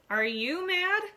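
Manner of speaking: high, loud outraged shout; angry shout. A narrator's reading. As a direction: bellow it at him. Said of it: "he shouts".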